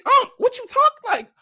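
very high, loud disbelieving comeback; retold incredulous protest. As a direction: act out challenging an older man's tone. Retells protest to older cop.

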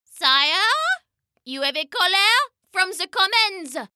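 high, formal page voice; high court herald call; announcing page. Acted.

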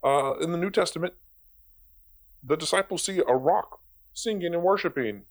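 ordinary talk

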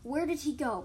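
heated, insistent hiss; hissed demand. A narrator's reading. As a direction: demand to know, fuming. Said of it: "Moonpaw hissed".

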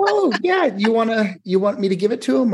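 high put-on voice of a breezy receptionist; mocking secretary voice. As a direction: play the secretary as sweetly dismissive.

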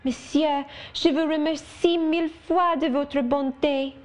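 put-on high, gushing little French girl; a theatrical thank-you from a small girl; exclaiming child. Adele kneeling before Rochester, gushing thanks in a high voice.

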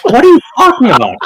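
high, fast disbelieving cry; incredulous. Retold or imitated. Retelling his shock at the groom about his father's story, high incredulous. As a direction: blurt it out in shock.

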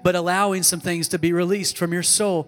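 impassioned preaching. Acted. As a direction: preach it with rising conviction. Preaching with rising force.

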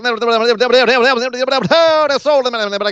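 high, rapid-fire auction chant; hammed-up livestock auction patter; auctioneer. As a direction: rattle off bids like an auctioneer. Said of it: "that was like an auctioneer".

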